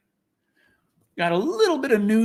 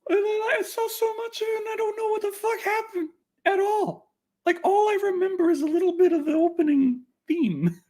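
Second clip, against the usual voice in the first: mock crying. Real, not acted. Laments forgetting a show he watched.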